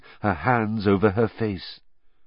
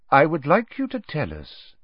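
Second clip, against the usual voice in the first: ordinary delivery, nothing marked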